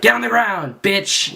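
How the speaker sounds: high mock cop bark; imagined cop yell. Imagined cop ordering the skater down, high mock bark. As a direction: ham up a rough cop barking an order. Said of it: "waiting for the guy to pull out his taser and be like".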